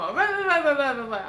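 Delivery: imitating the mother's shouting with loud rah rah; mock ranting rah rah. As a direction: blare out rah rah like a ranting mum. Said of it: "went into a rage".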